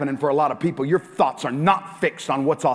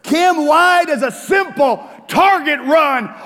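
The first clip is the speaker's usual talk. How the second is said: higher, louder, shouting at her; retold angry rant; 13 semitones higher, 10 dB louder than usual. Pastor re-enacting yelling at his wife over pillows, shouting. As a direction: blow up about the pillows like you did that day. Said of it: "I lost it".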